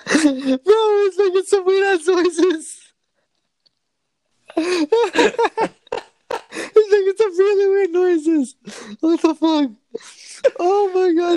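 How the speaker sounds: high, squealing laughing speech; real giggling fit, pitch way up; cracking up. Real, not acted. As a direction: squeeze the words out through helpless laughter. Helpless laughter at glitchy call noises.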